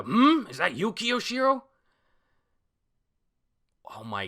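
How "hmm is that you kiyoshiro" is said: high, loud startled growl; loud high surprised demand; Kaido voice. Retold or imitated. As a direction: bellow it like a surprised giant. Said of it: "I guess this is Kaido".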